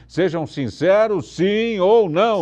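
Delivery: loud, slow, rising call to crowd; rally the students to shout back; excited shout. Real, not acted. Host rallies students to answer.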